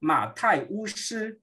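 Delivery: "Ma Tai Wu Shi" said slowly, syllable by syllable; slow teaching demo of "Ma Tai Wu Shi"; Mandarin demo. Shown as a demonstration. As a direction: model "Ma Tai Wu Shi" for students. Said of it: "ele traduziu com quatro ideogramas".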